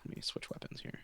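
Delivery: whispered aside to himself; whispering. Real, not acted. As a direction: murmur it under your breath. Mutters while switching weapons.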